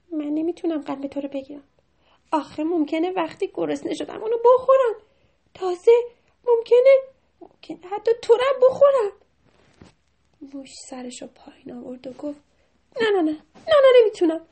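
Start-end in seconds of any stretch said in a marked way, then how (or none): 0.1-9.1 higher and louder as it goes; anxious mouse character. A narrator's reading. The mouse warns Mehrabun, building anxious voice. 13.0-14.4 high, loud rapid-fire no's; frantic refusal. A narrator's reading. The mouse refuses the heart, high frantic voice.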